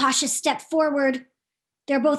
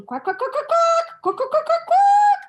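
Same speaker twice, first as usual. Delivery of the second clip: rising quacks climbing to a high loud squawk; quacking; 5 dB louder than usual. Acted. Theodora practicing quacks up to high C, climbing high. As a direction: quack up the scale to a top note.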